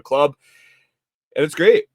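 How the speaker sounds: excited. Real, not acted. Enthusing about the coffee club.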